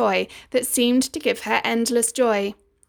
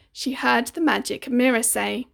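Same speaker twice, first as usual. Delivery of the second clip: usual speaking voice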